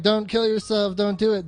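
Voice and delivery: ordinary talk, nothing marked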